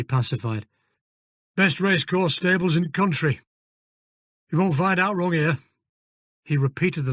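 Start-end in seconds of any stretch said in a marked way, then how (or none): 1.6-5.6 put-on broad northern English accent; gruff Yorkshire-style stable manager. Fergus voiced in northern accent.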